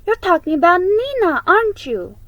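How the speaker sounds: a big sister jumping in eagerly; eager Ana. A narrator's reading. Ana's voiced lines, eager.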